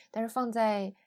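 ordinary delivery

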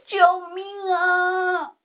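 slow, drawn-out, singsong cry for help; hoarse shout. A narrator's reading. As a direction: call until the voice cracks. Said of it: "几乎把嗓子都喊哑了". (喊到嗓子快哑的呼救)

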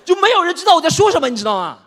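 high, very loud outburst; comic cry of frustration; exasperated shout. Acted. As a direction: yell it out in despair. Exasperated nobody understands him.

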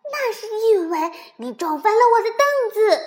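high, yelled accusation; angry roar. A narrator's reading. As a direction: roar the blame back at your friend. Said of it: "蹦蹦吼起来". (高声吼着责怪)

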